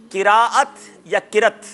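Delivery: slow "قراءت" as ki-raa-at, then short "کرت" as ki-rat; drawn-out "قراءت", then clipped form "کرت"; contrasting two pronunciations. Shown as a demonstration. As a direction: pose "قراءت یا کرت", three syllables against two. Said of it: "تلفظ ہمیں درست بتانا ہے".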